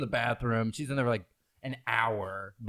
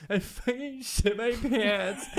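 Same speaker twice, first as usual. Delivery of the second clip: high, slow, laughing through a mock sob; drunk crying girl; 10 semitones higher, slower than usual. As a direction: play the wailing drunk teen, half laughing. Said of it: "that's how she talks".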